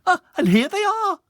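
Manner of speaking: shrill proud mum at the door; mother's voice. Acted. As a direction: announce the guests like a thrilled mother. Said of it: "said his mother".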